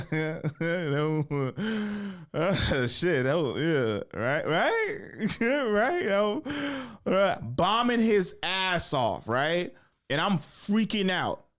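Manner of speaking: cracking up high mid-sentence; words squeezed out through laughter; breaking into giggles while telling it; laughing. Real, not acted. Laughing while recounting the bombing.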